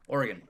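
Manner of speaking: Oregon squeezed to OR-gun; compressed local pronunciation. Shown as a demonstration. Local shows the host how Oregon is said, squeezed OR-gun. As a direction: say Oregon without the middle vowel. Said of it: "one syllable".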